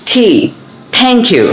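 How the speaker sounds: "টি" and "thank" both with puffed t; aspirated T. Shown as a demonstration. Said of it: "এভাবে করে কিন্তু এখানে". ("টি", "thank" ঠ দিয়ে উচ্চারণ)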